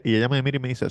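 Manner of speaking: usual speaking voice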